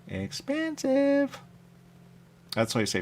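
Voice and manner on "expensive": slow, high, stretched-out "Expensive"; drawn-out complaint. Retold or imitated. Grumbling about restaurant prices, slow and high. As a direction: stretch the word out in mock shock.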